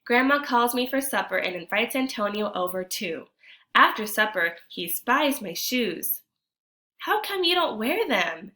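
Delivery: storytime reading voice, a touch lively; story narration. A narrator's reading. Teacher reads the picture book to kids, lively narration. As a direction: read the tale to the class.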